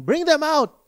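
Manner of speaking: loud, high demanding yell; mob shouting. Retold or imitated. Crowd banging on Lot's door, loud yelling. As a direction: bellow it like an angry crowd at the door.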